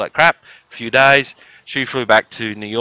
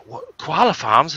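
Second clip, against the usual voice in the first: slow, higher, incredulous; mock amazed guest; 6 semitones higher, slower than usual. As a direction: drag out the question like a stunned guest.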